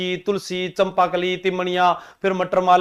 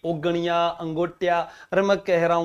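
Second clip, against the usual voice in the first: ordinary talk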